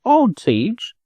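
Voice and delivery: high, hesitant little chicken voice. Acted.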